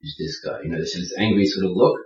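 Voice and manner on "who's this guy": near-whispered, much softer and lower; suspicious mutter. Retold or imitated. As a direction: voice a wary stranger sizing him up. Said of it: "this stare in their eye".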